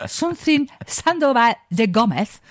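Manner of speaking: Spanish lisp on Sandoval de Gomez; lisped Spanish name. Shown as a demonstration. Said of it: "ich hab so perfekt gelispelt".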